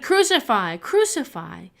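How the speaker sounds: loud, high crowd chant; shouted demand. A narrator's reading. Chief priests shouting at Pilate, loud demand. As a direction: yell it like a mob. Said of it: "they shouted".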